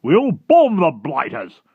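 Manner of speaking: slow, high, very loud battle cry; military shout. Acted. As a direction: shout it like a blustering Air Force chief. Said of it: "shouted the head of the Air Force".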